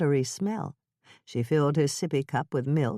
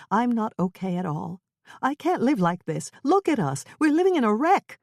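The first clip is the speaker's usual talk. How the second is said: voice rising; 7 semitones higher than usual. A narrator's reading. Wife to husband about the wrecked house, rising anger. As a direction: let the anger build line by line. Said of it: "Her voice rose".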